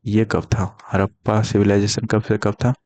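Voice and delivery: usual speaking voice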